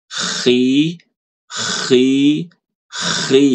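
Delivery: khi with the kh rasped first, then i; rough khi. Shown as a demonstration. Teacher asks student to copy khi. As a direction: start khi with a throaty scrape. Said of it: "Coba Ibu ikuti suara saya".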